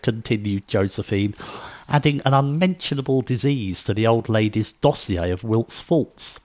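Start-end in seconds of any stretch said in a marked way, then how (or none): none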